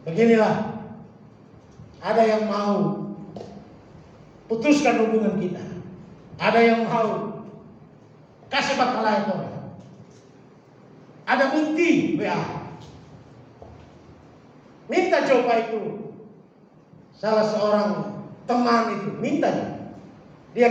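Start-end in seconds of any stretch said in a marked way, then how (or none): none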